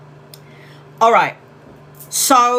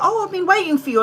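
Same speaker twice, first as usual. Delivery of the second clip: high, lilting mock mum; sweet mum voice; 10 semitones higher, faster than usual. Retelling mum greeting the nephew, high and sweet. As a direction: lightly voice a sweet welcoming mother. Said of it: "She was like".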